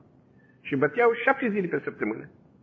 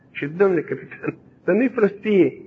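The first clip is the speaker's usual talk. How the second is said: ordinary talk, nothing marked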